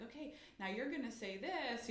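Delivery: put-on gently cue the child; prompting mom. Mom cueing son's line, soft sing-song. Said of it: "And then I'm like".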